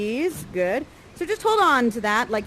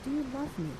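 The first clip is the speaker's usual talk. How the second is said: much softer, almost hushed question; soft, tender asking; 4 semitones higher, 9 dB quieter, much faster than usual. Shown as a demonstration. Miranda quietly asks Ferdinand if he loves her. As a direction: ask it gently under your breath. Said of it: "she asks".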